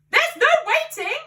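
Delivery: high, loud excited father's cry; cried out. A narrator's reading. Excited father cries to George, high and loud. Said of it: "cried Mister Cranky".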